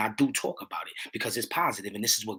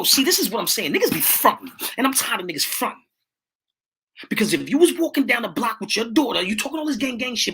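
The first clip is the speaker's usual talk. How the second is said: louder, higher, fired-up street preaching; heated rant; 9 semitones higher, 4 dB louder than usual. Real, not acted. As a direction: call them out, voice climbing. Heated rant against fronting.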